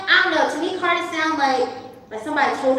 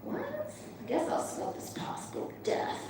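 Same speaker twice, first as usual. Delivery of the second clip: wary buyer aside; 9 semitones higher, 14 dB quieter than usual. Retold or imitated.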